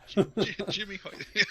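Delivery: hammed-up Irish accent on Jimmy Hayter; Irish accent, laughing through it. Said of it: "That's an Irish accent".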